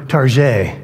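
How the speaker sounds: Target said the French way, tar-ZHAY; lightly mock Target as "tar-zhay" with the stress on the end. Shown as a demonstration. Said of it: "make Target sound cool by saying".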